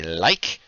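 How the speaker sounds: "like" with long i, hard k; pronunciation like. Shown as a demonstration. Game master explaining who says it with long i. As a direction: say "like" so it rhymes with bike. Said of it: "People who say like".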